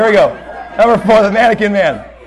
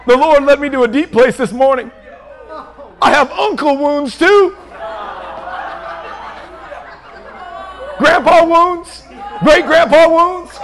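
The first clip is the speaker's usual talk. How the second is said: mock spiritual deliverance; 4 semitones higher than usual. Mocking super-spiritual prayer talk.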